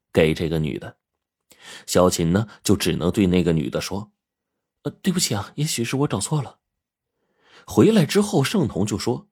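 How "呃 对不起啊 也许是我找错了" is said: soft, higher apologetic voice; sheepish apology. A narrator's reading. Xiaoqin to the woman at the door, apologetic. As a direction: back off politely, quieter and higher.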